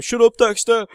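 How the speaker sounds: imitated loud, high-pitched yell; re-enacted exasperated dog-scolding shout. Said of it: "I'm always shouting at him going".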